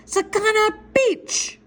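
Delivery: very high, stretched-out yell; yelled magic word. Acted. Boy yelling the magic word at the guards, high yell. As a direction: holler it up at the guards, drawn out. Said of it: "yell out the magic word real loud".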